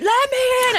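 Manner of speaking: high loud plea; calling to be let in. Retold or imitated. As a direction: call through the window to be let in. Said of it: "knocking on the window going".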